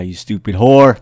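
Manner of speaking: sudden loud insult; mock angry outburst. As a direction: burst out loudly in pretend outrage. Flirting flips to mock outburst.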